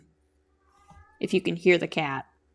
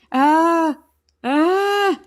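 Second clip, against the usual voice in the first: drawn-out mock screech like her cat, higher and louder; a hint of a yowling cat; imitating cat scream; 9 semitones higher, 6 dB louder than usual. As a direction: ape the cat's scream, slowly. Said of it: "Just open her mouth and scream".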